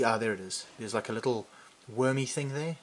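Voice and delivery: usual speaking voice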